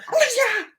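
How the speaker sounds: high, loud call across the house. Retold or imitated. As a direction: shout a name from another room. Mom calling out to daughter.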